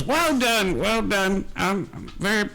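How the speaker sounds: put-on high-pitched old woman handing out praise; older woman. Older woman praising the winner, in character. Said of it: "Uh, she goes".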